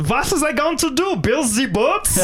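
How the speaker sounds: high, hammed-up German accent. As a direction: rant as a cartoon German dictator, stretching the end. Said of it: "that's a German accent".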